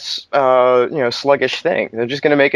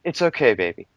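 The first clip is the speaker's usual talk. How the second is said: soothing a scared dog; 7 semitones higher, much faster than usual. Pretend. Calming ranting friend.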